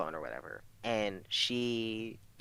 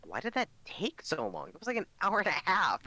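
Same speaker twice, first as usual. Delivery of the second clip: imitating a mom's exclamation, pitch leaping up; mock-astonished, swelling louder and higher at the end; incredulous mom; 9 semitones higher, 3 dB louder than usual. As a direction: exaggerate a parent's disbelief. Mom voiced in a story.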